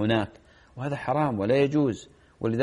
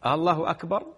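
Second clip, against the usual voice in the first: "آلله أكبر" raised and ending on a rise; as a question; 4 semitones higher, faster than usual. Shown as a demonstration. Sheikh showing it sounds like asking, as a question. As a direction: ask it, aallaahu akbar?, with lengthened first vowel. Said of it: "كأنك الآن تستفهم".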